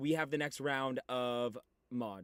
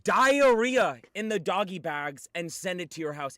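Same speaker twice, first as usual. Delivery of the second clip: ordinary delivery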